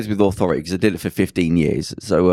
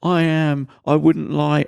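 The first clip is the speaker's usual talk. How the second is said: imitating a crying girl, pitched up; higher, lightly acted tearful pleading; mock tearful protest; 7 semitones higher, slower than usual. Girl pleading her age to the doorman, mock tearful. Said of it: "this girl starts crying".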